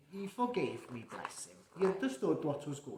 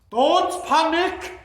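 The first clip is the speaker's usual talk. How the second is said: higher, louder jolly Santa; hammed-up Santa reassuring; 10 semitones higher, 14 dB louder, slower than usual. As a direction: boom it out to calm everyone. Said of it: "says Santa".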